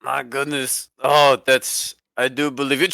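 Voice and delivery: ordinary talk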